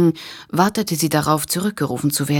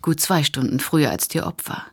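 usual speaking voice

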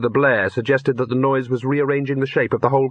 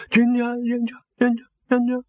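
high, thin echo of a shout; 12 semitones higher than usual. A narrator's reading. Victor's call bounces along the caverns, echoing. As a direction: repeat the name like a fading cavern echo. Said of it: "He could hear it bouncing away".

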